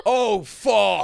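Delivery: loud, high Brooklyn exclamation; hammed-up Brooklyn onlooker; Brooklyn local. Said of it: "from Brooklyn would be like".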